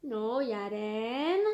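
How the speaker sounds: slow, low drawn-out no; gentle warning. Real, not acted. Scolding toddler gently.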